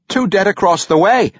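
lightly voiced halfling announcing. A narrator's reading. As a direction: announce the news to the crowd. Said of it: "announced a newcomer".

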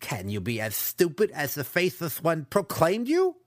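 high, incredulous jeer; lisping contempt; scornful insult. Acted. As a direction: rise into a mocking question. Captor insults the captive.

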